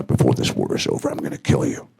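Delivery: low, hushed, menacing hero's threat. Retold or imitated. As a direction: growl the threat quietly, low and deadly. Mel's threat acted out.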